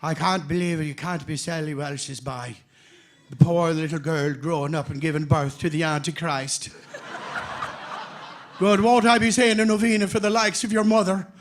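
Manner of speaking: put-on loud, lamenting old-country nun; hammed-up Irish nun's outrage; Sister Mary Brendan. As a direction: imitate an appalled nun scolding a child. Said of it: "Sister Mary Brendan, I remember her saying".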